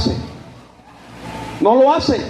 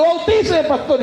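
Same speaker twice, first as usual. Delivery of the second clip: crying plea; 7 semitones higher than usual. Retold or imitated. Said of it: "Y él llorando".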